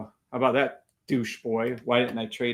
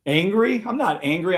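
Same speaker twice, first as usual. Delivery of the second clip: high-pitched disbelieving echo of the accusation; incredulous; 6 semitones higher than usual. Real, not acted. Streamer answering a viewer who calls him angry, incredulous. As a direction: pitch up as if the idea is ridiculous.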